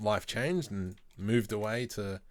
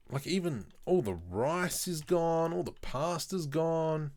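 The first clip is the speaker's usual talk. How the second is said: exasperated complaint; 7 semitones higher than usual. Real, not acted. Complaining about empty shops.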